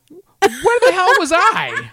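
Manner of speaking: loud, high, drawn-out disbelief; incredulous outburst. Real, not acted. Husband finding out she met club owners, incredulous outburst. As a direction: cry out in baffled protest, half laughing. Stunned at partner's news, exclaims.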